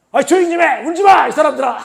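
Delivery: 높고 크게 내지르는 호통; 시끄러운 무리를 조용히 시키듯 외치기; 꾸짖는 외침. Retold or imitated. (울부짖는 사람들에게 크게 호통치듯)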